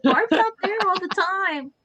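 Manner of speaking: high and loud, protesting the teasing; excited protest. Real, not acted. Teasing banter about Barb.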